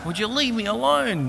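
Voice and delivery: very high mock scolding; high-voiced comic telling-off; imitated annoyed wife. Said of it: "My wife's going".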